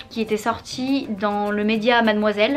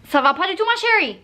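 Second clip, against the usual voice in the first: sassy scolding; 7 semitones higher than usual. Retold or imitated. Mocking own mirror reflection.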